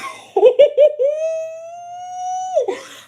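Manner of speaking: high-pitched burst of laughter. Real, not acted. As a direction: crack up laughing. Laughing at the memory.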